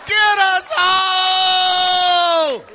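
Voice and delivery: high, loud, drawn-out scared wail; stretched panicky shout to go home; kids pleading in panic. Retold or imitated. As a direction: plead like a terrified little kid. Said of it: "We're like".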